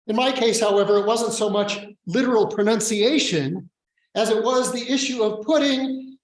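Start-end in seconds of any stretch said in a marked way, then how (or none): none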